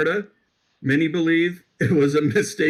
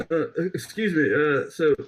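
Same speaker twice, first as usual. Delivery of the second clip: ordinary talk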